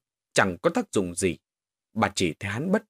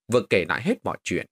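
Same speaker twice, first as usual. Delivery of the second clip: usual speaking voice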